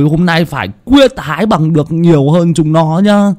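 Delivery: put-on high, loud boyish voice, aggrieved; indignant boy. Hưng complaining about Tuấn Còi to Tèo, high aggrieved boyish voice. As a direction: vent about being teased and vow revenge.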